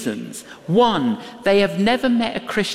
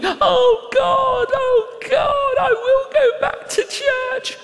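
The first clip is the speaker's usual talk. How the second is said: retold weeping cry. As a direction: cry out to God through tears, much higher. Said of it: "he starts crying. He's weeping".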